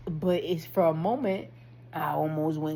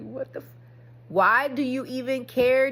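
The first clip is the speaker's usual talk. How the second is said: loud, higher challenge to yourself; raised, emphatic self-questioning; exasperated self-talk; 5 semitones higher, 8 dB louder than usual. Retold or imitated. Confronts himself in self-talk.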